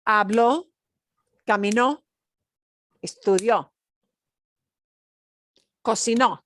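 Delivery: habló, caminó, estudió, cocinó, each final ó punched loud; stress on the final O. Shown as a demonstration. Teacher drilling past-tense endings, punching the ó. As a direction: punch every last ó. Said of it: "you have to punch that O at the end".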